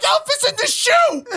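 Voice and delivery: very high, shouted child impression; excited kid. Son telling dad about the elf, mock excited squeal. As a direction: squeal the big news like a little kid. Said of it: "He's like".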